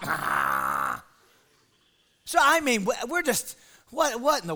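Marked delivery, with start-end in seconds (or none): 0.0-1.0 very low, dragged-out groan; sleepy grunt. Retold or imitated. Bobby answering half asleep, deep drowsy grunt. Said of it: "He'd just fall asleep".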